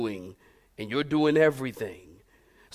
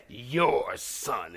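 softer, disdainful "Your son"; 5 semitones lower, 5 dB quieter than usual. Retold or imitated. Wife blaming husband for boy, disdainful. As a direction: hand him off with scorn. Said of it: "she would always say it with such disdain".

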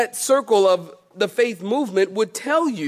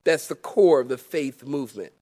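normal talking voice

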